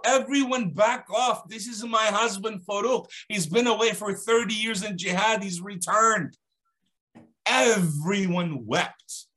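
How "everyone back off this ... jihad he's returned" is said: raised high calling voice, clearing the crowd. Acted. Wife shouting to the neighbours to back off, in a high, raised voice. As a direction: call out over the fighting to stop the crowd. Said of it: "She shouts out".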